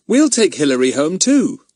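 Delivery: lightly voiced Larry; Larry wanting to join; eager boy. Larry wants to come.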